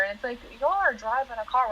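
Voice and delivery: normal talking voice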